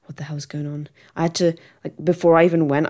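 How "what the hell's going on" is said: whispered bewildered question; unvoiced mock confusion; confused whisper. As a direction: hush it like you're lost and panicking. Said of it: "I was like".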